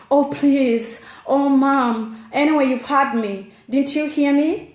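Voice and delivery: put-on exasperated, insistent teen complaint; pestering teenager. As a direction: act out an impatient teen demanding to be heard. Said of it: "They'll be like".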